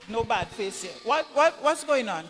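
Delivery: normal talking voice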